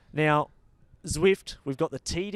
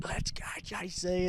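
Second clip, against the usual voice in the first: muted mock yell, higher and drawn out; retold yell; 6 semitones higher, 5 dB quieter than usual. Commentator retelling his cheer for a rider, held-back yell. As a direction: imitate your own cheer without full volume. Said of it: "I was just yelling".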